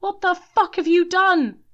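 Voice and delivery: very high, loud furious outburst; seething. A narrator's reading. Denny raging at Matthews after the shooting, furious. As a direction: rage at him. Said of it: "seethed Denny".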